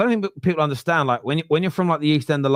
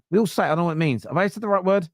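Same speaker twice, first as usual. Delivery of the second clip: ordinary delivery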